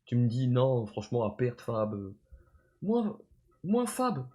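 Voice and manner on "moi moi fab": high, indignant yelp of disbelief; mock outrage. Streamer voicing the rapper's shock at the comparison, high indignant yelp. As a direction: play the offended rapper, pitched way up.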